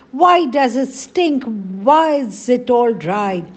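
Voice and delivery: high, loud anguished questions; distressed cry. A narrator's reading. Anju questions her polluted stream, loud and distressed. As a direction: plead with the stream in alarm. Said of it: "Anju cried".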